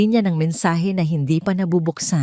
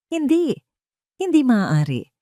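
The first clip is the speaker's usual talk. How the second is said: shocked denial; 7 semitones higher than usual. A narrator's reading. Wife in shocked disbelief.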